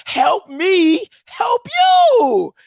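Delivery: high, wailing mock plea; hammed-up crying plea to be saved; mock pleading cry. Imitates pleading coach.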